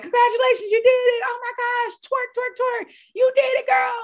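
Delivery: high, loud, gushing celebration; excited cheering. Retold or imitated. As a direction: squeal it like you're cheering a friend on.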